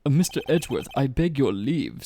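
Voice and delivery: a hint of snooty politeness. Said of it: "snooty voice".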